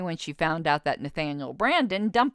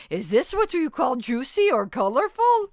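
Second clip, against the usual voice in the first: louder, higher attempt at a Russian accent; 6 semitones higher, 6 dB louder than usual. Shown as a demonstration. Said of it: "I can't do a Russian accent, but I'm gonna try it anyway".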